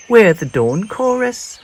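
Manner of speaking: high, loud chirping bird; chirpy bird. A narrator's reading. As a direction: proudly chirp it out. Friendly bird continues.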